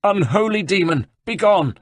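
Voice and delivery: abbot's outcry. Acted. Abbot banishes Ezio.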